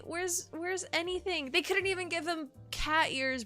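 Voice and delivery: ordinary talk, nothing marked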